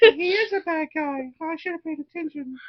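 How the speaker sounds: very high falsetto; mock kid voice. Adult joking as the kids facing Vecna, silly high put-on voice. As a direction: squeak a regretful aside. Put-on voice after kids' reaction.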